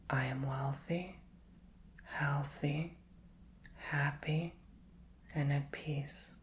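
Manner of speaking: soft, slow, half-whispered affirmations; gentle affirmation chant for the viewer. Shown as a demonstration. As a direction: say it like a mantra you believe. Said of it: "Repeat this to yourself".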